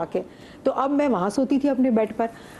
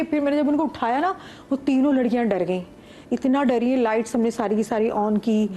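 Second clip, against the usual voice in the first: ordinary talk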